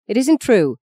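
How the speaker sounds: desperate, pleading denial. A narrator's reading. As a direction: blurt it out in desperation. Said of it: "said Maggie desperately".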